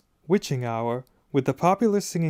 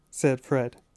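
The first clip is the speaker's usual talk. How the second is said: usual speaking voice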